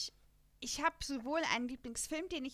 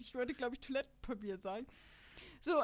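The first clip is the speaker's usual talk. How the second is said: normal talking voice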